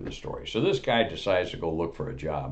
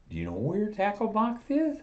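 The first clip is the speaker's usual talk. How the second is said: eager salesman. Acted. Eager salesman upselling customer.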